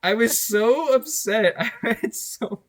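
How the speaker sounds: cracking up mid-sentence; laughing. Real, not acted. Laughing while telling a friend how upset he was.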